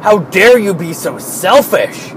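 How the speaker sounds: slow, shouted mock indignation; hammed-up how-dare-you shout; mock outraged scolding. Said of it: "They're like".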